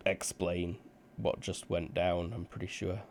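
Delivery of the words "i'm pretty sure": ordinary talk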